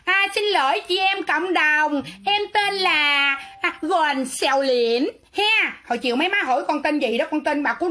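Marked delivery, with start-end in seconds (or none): 0.0-5.1 drawn-out, slow show of apologising; mock formal apology. Streamer introducing herself to the community to apologise, mock formal apology. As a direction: stretch each phrase out in an exaggerated apology.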